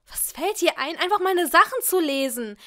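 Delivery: angry snap. A narrator's reading. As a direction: bark at him for reading your book. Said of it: "blaffte ich ihn an". (wütend anblaffen)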